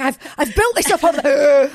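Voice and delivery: half-laughing complaint; laughing mock despair. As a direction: laugh through the mock wail. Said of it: "I was like".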